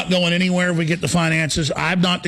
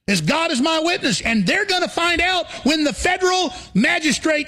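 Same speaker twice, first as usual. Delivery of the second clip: indignant rant, pitch much higher; rising outrage, voice pushed up high; 9 semitones higher than usual. Real, not acted. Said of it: "Sorry, I'm ranting".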